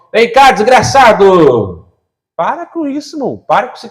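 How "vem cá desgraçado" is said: slow, louder mock summons; hammed-up divine call to a wretch; mock God calling. Preacher mocking a self-pitying believer with God's call, slow. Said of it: "Eu sorteei no bingo do céu".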